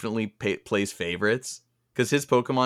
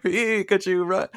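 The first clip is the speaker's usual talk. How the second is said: Ash calling out; 8 semitones higher, slower than usual. Retold or imitated. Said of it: "he's like".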